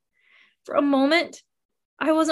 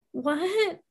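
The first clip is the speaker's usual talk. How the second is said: whimper; 5 semitones higher, 5 dB quieter than usual. Acted. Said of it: "I whimpered".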